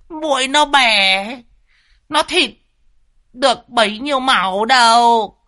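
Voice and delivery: high, teasing, cackling voice; cackling old man. A narrator's reading. Ông Đồng cười khanh khách trêu cậu Tẩy về con muỗi. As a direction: cackle and mock him between words. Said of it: "cười lên khanh khách".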